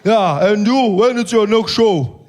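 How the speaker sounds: menacing slow greeting, weighing each word; Malema voice. Retold or imitated. Malema greets the comedian at his table, slow and menacing. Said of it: "He says".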